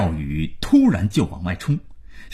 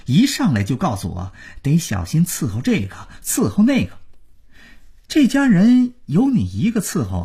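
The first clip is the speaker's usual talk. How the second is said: high-pitched angry outburst; indignant; 9 semitones higher, faster than usual. A narrator's reading. Son confronts father over serving the family, indignant. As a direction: fire back in a rising, aggrieved voice. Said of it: "廖宇气愤地问".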